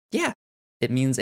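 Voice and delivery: usual speaking voice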